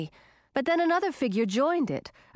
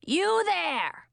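shouting; 8 semitones higher than usual. A narrator's reading. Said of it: "the old witch shouted".

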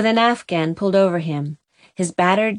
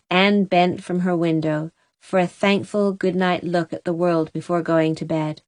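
usual speaking voice

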